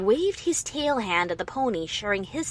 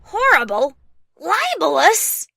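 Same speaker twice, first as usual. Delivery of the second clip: much higher and louder, outraged disbelief; indignant yell; 8 semitones higher, 7 dB louder than usual. Acted. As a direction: hurl the words back at him. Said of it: "I couldn't keep from yelling".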